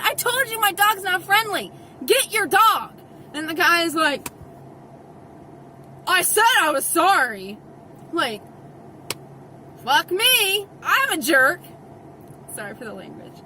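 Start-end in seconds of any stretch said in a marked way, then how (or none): 0.0-2.9 louder, higher, insistent demand; re-enacted stern warning. Owner confronting the guy last time, loud retold demand. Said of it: "I told the guy, I said". 6.1-7.6 loud, irritated mock retort; retold snapping back. Guy answering the dog owner, snapped mock apology. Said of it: "the dude has snapped at me". 9.9-11.6 loud, mock-contrite exclamation; sarcastic self-blame. Owner reacting to being snapped at, loud sarcasm.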